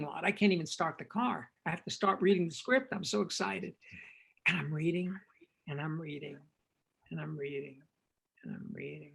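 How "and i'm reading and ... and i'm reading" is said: starting as a whisper and fading much softer; fading repetition. Retold or imitated. Director reading the script in his car, fading away. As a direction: let each repeat sink quieter.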